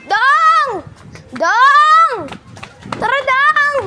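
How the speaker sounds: loud high call across the yard. Real, not acted. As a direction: shout his name. Calling out to kid.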